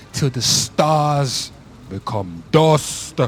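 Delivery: grand declaration. Acted. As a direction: proclaim it to the heavens.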